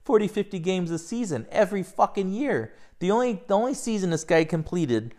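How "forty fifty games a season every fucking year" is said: exasperated retort. Retold or imitated. Replying to a Chronicle writer, exasperated and mocking. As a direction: throw it back at him like it's obvious. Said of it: "And I was like".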